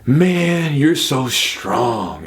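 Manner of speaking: drawn-out mock excuse; imitated complaint, very slow on the last words; quoted excuse. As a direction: stretch the words out, mimicking a sore loser. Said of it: "people will often make the, quote".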